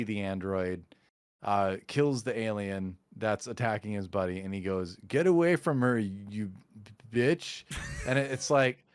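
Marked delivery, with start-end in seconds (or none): 5.1-7.6 "you", then a hesitant stop before "bitch"; halting delivery of "bitch" after a pause. Retold or imitated. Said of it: "there's this pause".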